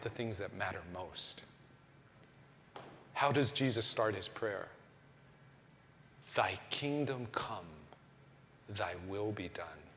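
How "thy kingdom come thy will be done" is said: reverent quote. Shown as a demonstration. Quoting Jesus' prayer softly.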